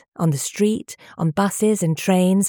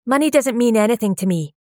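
higher, louder insistence; stubborn; 5 semitones higher, 3 dB louder, faster than usual. A narrator's reading. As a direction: dig in, refuse to budge. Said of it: "she'd argued stubbornly".

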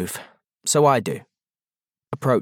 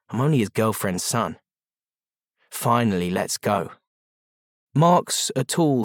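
ordinary delivery, nothing marked

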